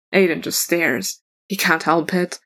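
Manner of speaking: ordinary delivery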